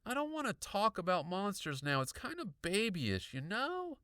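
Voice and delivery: put-on high kid's voice, unimpressed; dismissive boy. A narrator's reading. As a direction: brush it off as childish. Boy dismissing monster talk.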